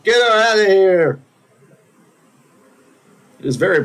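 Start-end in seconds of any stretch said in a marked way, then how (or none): 0.0-1.2 loud, high, slowed mock crowd shout; crowd yelling. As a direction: yell it like the whole annoyed crowd. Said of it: "everyone's like".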